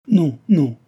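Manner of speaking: emphatic refusal. A narrator's reading. Cécile rejects Delville's reassurance, emphatic protest. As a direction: cut across with a forceful protest.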